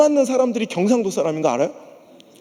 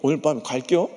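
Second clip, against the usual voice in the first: low, noncommittal mock acceptance; evasive Chungcheong reply; 7 semitones lower, 3 dB quieter than usual. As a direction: say yes while quietly meaning no, Chungcheong style. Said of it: "근데 충청도 사람 그럽니까".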